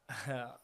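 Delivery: a brief amused laugh; a short laugh at the absurd news. Retold or imitated. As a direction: let out one quick disbelieving laugh. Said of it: "I just, like, laughed".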